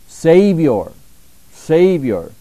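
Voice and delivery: Savior called out loud and falling; emphatic repetition. Shown as a demonstration. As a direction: ring out the word Savior twice. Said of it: "we do like to emphasize".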